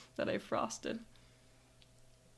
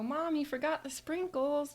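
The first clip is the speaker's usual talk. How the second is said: put-on child's voice; 7 semitones higher than usual. Child self reminding mom.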